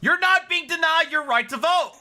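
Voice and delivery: shouting back at the screen; exasperated yell; loud. Real, not acted. Yells back at the clip.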